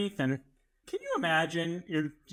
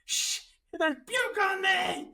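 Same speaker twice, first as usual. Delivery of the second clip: high, slow, whiny yell; whiny kid shout; slower than usual. Retold or imitated. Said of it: "he was like".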